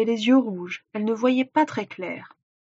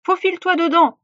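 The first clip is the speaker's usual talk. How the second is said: high, raised order; commanding; 7 semitones higher, 4 dB louder than usual. A narrator's reading. Witch to Gretel at the hot oven, commanding. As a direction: order the child about sharply. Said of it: "ordonna-t-elle".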